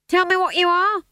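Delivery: high, loud order; commanding. A narrator's reading. Stage manager to trembling witches, commanding. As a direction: demand it forcefully. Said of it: "he commanded".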